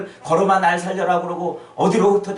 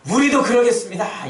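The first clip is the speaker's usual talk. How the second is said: louder, higher 우리도 그러겠습니다 in unison; in chorus; 9 semitones higher, 8 dB louder than usual. Acted. Disciples in chorus, loud 우리도 그러겠습니다. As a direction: declare 우리도 그러겠습니다 together. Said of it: "이구동성으로".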